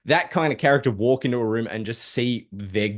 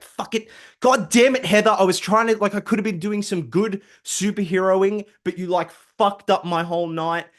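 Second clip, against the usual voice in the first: annoyed Matt; 8 semitones higher than usual. Acted. Matt raging at Heather over his ruined night, pitched-up angry rant. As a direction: blow up at the wasted night. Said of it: "he's all annoyed".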